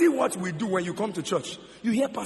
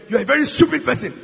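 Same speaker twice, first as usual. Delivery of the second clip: quick, louder, higher retold insult; mock angry insult; 4 semitones higher, 10 dB louder, faster than usual. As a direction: imitate a stranger hurling abuse, fast. Stranger insulting you on the road.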